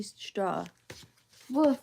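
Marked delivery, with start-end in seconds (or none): 1.5-1.8 puppy bark. Acted. Puppy Biscuit barks in story.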